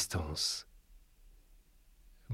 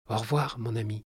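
softer tender farewell; gentle and affectionate; 4 dB quieter than usual. A narrator's reading. The animal friend bids the narrator farewell, softly and tenderly. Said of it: "avec douceur et affection".

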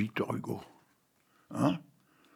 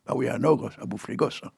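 normal talking voice

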